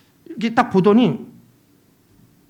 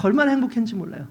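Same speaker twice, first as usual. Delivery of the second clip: normal talking voice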